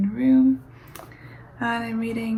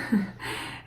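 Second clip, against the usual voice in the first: brief, soft breath of a laugh; quiet self-conscious chuckle; small laugh; 4 dB quieter than usual. Real, not acted. Poet introducing her poem to listeners, small breathy laugh.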